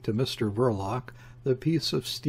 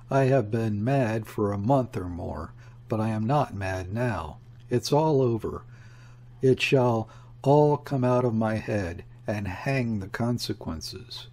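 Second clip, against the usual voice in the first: ordinary delivery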